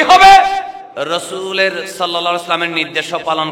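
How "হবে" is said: high, loud, drawn-out insistence; emphatic shout. Real, not acted. Preacher insists Allah's command be obeyed, emphatic slow shout. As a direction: drive it home at full force.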